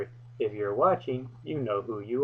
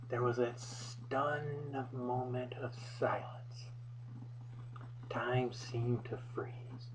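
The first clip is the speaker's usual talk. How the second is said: whisper; 11 dB quieter, slower than usual. Acted. Dramatic pause in the fight story.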